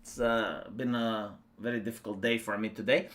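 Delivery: drowsy, low, fading voice; half asleep, barely getting words out; nodding off. Real, not acted. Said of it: "I'm falling asleep".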